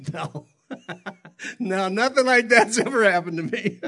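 real laughter through the words; laughing as he admits it. Real, not acted. Pastor telling the congregation his answer, laughing for real. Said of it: "And I laughed just like I am now".

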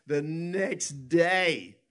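"The next day" loud, high and very slow; emphatic drawn-out NEXT DAY. Real, not acted. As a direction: stretch out "The next day" for dramatic effect, falling at the end. Dramatic emphasis in testimony.

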